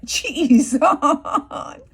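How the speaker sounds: loud cry of dismay, cracking with laughter; loud laughing exclaim. Retold or imitated. Vlogger mimicking the boy's outburst in court, loud and laughing. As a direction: blurt it out loud while laughing. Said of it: "he's like".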